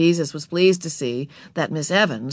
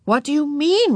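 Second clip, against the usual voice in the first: higher, mildly alarmed question; alarmed mother; 10 semitones higher, 4 dB louder than usual. A narrator's reading. Mother questioning Ramona about the book, mildly alarmed. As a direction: ask with a touch of alarm. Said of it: "Mother looked alarmed".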